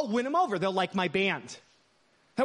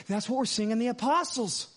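ordinary talk, nothing marked

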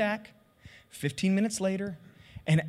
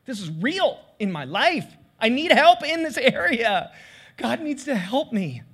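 loud, raised-pitch plea for help; urgent, forceful cry for help; impassioned admission; 3 semitones higher, 5 dB louder than usual. Acted. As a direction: confess it emphatically, wide open. Acted frank admission to trusted friends.

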